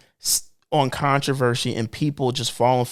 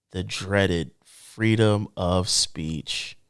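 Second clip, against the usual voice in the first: very slow, low announcement; mock-solemn, dragging every word; mock dread; 7 semitones lower, 4 dB quieter, much slower than usual. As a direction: draw it out like an ominous title. Mock-dramatic naming of a hated topic.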